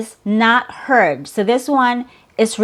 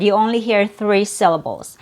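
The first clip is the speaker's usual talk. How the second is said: ordinary talk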